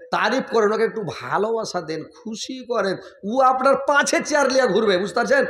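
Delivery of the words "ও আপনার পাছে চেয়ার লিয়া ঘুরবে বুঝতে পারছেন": higher and louder punchline; emphatic promise. Real, not acted. Preacher promising husbands a devoted wife, emphatic. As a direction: hit the payoff with energy.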